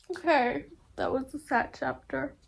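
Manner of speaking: high, teary voice after real crying. Real, not acted. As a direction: laugh through tears at a sad chapter. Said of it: "Immediately starts crying".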